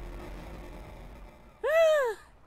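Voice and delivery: loud, high acted gasp; shocked gasp. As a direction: gasp in mock horror. Crowd shocked by mayor's death.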